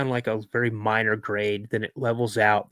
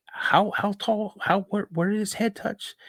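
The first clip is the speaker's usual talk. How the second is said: higher-pitched, halting questions as a puzzled dad; incredulous dad questioning; 10 semitones higher than usual. Retold or imitated. Dad asking his son how tall it was, incredulous. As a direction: imitate a dad stumbling over his questions.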